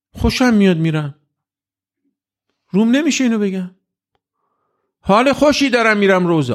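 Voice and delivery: ordinary talk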